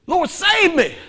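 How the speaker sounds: high, loud desperate plea; a sinking man begging the Lord; sinking cry for help. Retold or imitated. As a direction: call out as you start to sink. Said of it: "And he goes".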